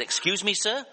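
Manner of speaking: high, rising puzzled query. Retold or imitated. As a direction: imitate a baffled secretary on the phone. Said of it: "she was a bit puzzled".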